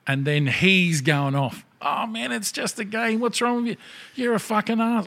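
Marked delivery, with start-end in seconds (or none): 1.8-5.1 high, indignant whining; a very high, indignant imitation of a sore-losing gamer; worked-up whiny gamer. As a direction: whine and rant like a sore loser. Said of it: "he was getting all worked up".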